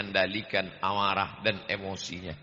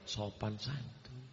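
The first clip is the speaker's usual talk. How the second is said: much softer, slower "Sopan santun"; softened; 2 semitones lower, 13 dB quieter, slower than usual. Shown as a demonstration. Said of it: "kita perlembut".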